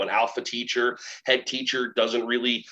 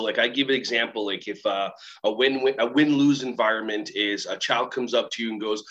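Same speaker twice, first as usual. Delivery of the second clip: ordinary delivery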